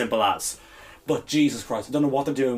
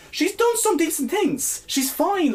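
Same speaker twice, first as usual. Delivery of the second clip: grudging concession; 13 semitones higher, slower than usual. Real, not acted.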